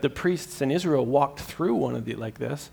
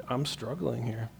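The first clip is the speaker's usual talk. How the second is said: honest quiet plea; 2 semitones lower, 11 dB quieter than usual. Retold or imitated. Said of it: "say in honesty".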